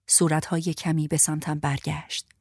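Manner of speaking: ordinary delivery, nothing marked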